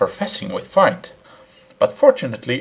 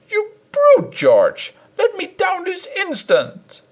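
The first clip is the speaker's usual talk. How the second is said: much higher, tearful demanding voice; high tearful fury from the pedestal; crying wife; 3 dB louder, slower than usual. A narrator's reading. Wife to her husband, a high tearful demand.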